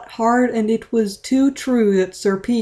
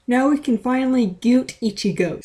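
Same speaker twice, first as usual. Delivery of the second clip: confident; 6 semitones higher than usual. A narrator's reading. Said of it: "said Otachi Confidentalu".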